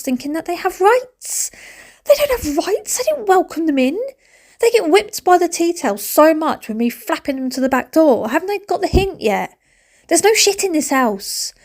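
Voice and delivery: high-pitched, outraged complaint; indignant rant. Real, not acted. Ranting about the flies.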